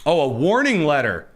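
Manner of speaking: ordinary talk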